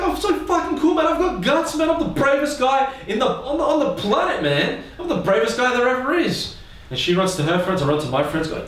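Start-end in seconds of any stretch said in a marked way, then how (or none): 0.0-6.5 put-on much higher, pumped-up kid boasting; excited bragging. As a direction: crow about it like a proud kid.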